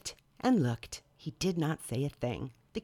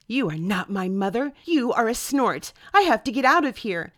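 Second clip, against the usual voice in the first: scared; 5 semitones higher, 8 dB louder than usual. Acted. Bird caught by digger.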